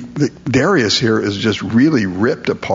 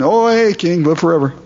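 "Oh, hey" tossed off high and breezy; casual, nothing happened; 6 semitones higher than usual. Retold or imitated. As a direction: greet the king like a buddy, unbothered. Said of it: "like nothing's happened".

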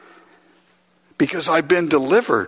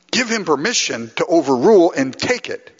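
ordinary delivery

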